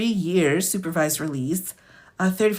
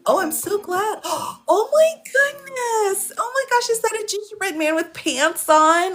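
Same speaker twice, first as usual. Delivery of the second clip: real high-pitched gushing, close to crying; tearful delight; 14 semitones higher than usual. Real, not acted. Streamer thrilled by gingerbread man with pants, tearful delight. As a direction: gasp and squeal with tearful joy. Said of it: "I'm trying not to cry".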